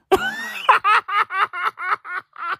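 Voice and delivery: high burst of real laughter; laughing. Real, not acted. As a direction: crack up at the slip. Laughing at his own slip.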